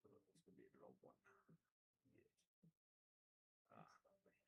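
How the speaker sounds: soft unvoiced whisper; hushed whisper over the craft table. Real, not acted. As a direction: breathe it to yourself. Whispered aside while crafting.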